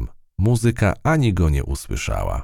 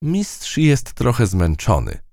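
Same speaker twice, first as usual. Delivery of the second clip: slow explaining; 8 semitones higher, slower than usual. A narrator's reading. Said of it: "wyjaśniła przyjaciółka".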